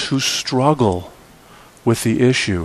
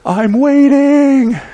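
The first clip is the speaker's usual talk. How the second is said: loud, high, drawn-out singsong demand; Calvin impatient; 12 semitones higher, 6 dB louder, much slower than usual. Acted. Calvin calls to the heavens for snow, loud impatient yell. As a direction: call it up to the sky, long and high. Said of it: "he looks to the heavens".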